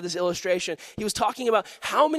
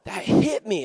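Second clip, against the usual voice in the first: ordinary talk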